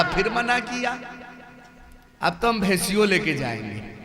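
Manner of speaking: soft, low, chuckling stubborn wife; laughing defiance. Acted. Stubborn wife defies her husband. (ہنستے ہوئے ضد)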